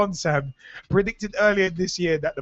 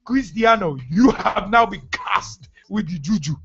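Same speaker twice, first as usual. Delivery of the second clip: hammed-up Ghanaian witch doctor pronouncement; put-on Ghanaian accent. Host mock-cursing Ronaldo as spiritualist, Ghanaian accent. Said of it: "I'm saying it in the accent".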